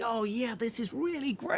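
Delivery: high, delighted boss voice; thrilled. Retold or imitated. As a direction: relish the prank, voice up high. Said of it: "he's going".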